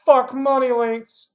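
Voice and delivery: troll's comment yelled, slow and loud; mock yell. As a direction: bellow it like an angry troll.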